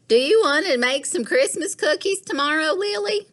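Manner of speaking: high, bright grandmotherly ask; Gran's voice. Acted. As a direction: sweetly ask Lily like a doting gran. Gran voiced asking Lily.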